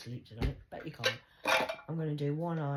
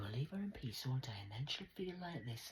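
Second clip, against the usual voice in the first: hushed unvoiced mimic of doctor; mock doctor whisper; 3 semitones lower, 8 dB quieter than usual. Mocking Doctor Death's plan, whispered.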